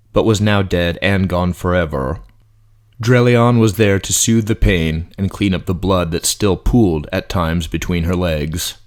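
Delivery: ordinary delivery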